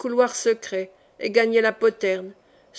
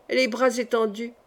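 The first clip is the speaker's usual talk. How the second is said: usual speaking voice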